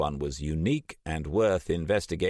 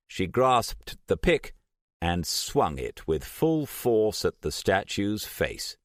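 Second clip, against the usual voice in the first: usual speaking voice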